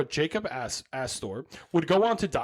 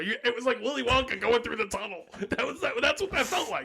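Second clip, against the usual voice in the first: laughing; 6 semitones higher than usual. Real, not acted. Teasing friend mid-laugh.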